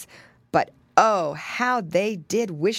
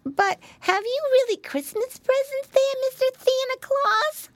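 put-on very high, lisping child question; piggy boy. A narrator's reading. Piglet asking Santa about presents, high eager voice. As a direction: ask Santa in a tiny eager squeak.

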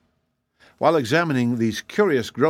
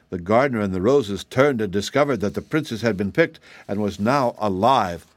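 usual speaking voice

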